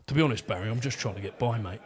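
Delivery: lightly voiced tired everyman getting by; working-class bloke. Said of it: "attitude of the working class".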